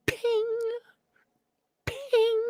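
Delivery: very high, tinny ping noise; high ping sound. Retold or imitated. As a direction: squeak out a metallic ping. Imitating peas pinging off steel.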